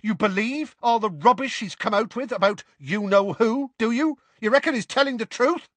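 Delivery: high, incredulous tirade; angry disbelief. A narrator's reading. Seamus challenges Ron's belief in Harry, angrily incredulous. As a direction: challenge him in a high, outraged voice. Seamus shouts at Ron in quarrel.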